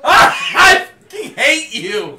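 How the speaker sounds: very loud, high, drawn-out comic fury; exasperated yell. Real, not acted. Viewer fed up with the announcer's pronunciation, exasperated high yell. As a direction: howl it out, utterly fed up. Fed up with commentator's rolled R's.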